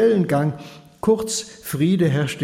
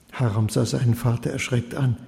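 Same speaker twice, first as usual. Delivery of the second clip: usual speaking voice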